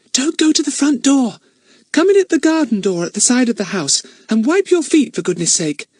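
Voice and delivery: put-on high little girl's voice; a small girl fussing from the window; Bet voice. Little Bet calls Ern to the side door, high and fussy.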